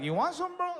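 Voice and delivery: high, laughing mock taunt; cocky bluff. Comic bluffing the other fighter, high laughing taunt. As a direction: taunt to cover the pain.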